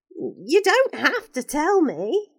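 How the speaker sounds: put-on shy boy. Ricky gently backs off from asking the woman, slow shy boy.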